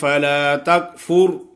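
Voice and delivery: slow melodic tajweed recitation; Quran recitation. Shown as a demonstration. Teacher reciting a verse for the student, slow and melodic. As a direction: recite slowly with measured tajweed.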